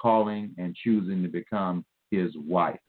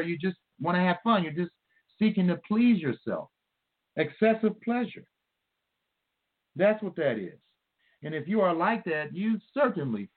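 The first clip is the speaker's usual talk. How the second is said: higher-pitched, insistent sermon delivery; fervent preaching; 9 semitones higher than usual. Real, not acted. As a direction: press each point home with question and answer.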